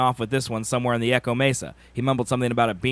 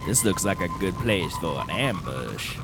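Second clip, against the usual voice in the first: cowboy voice; 8 semitones lower, 7 dB quieter than usual. Pretend. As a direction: play a gruff cowboy. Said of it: "That was my cowboy voice".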